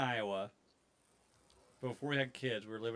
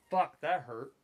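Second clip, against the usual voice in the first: louder mock cry of pain; retelling his pained curse, louder; retold yelp; 4 semitones higher, 7 dB louder than usual. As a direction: blurt it out like it just hurt. Headbutted in the mouth.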